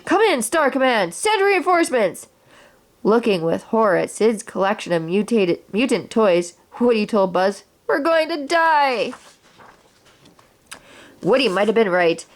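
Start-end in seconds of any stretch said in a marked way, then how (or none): 0.0-2.3 rising, higher radio call for help; frantic yelling. Acted. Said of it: "started yelling". 7.9-9.1 panic. Acted. Woody sees mutant toys.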